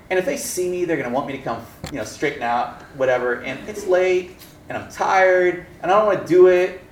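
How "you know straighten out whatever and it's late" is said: ordinary talk, nothing marked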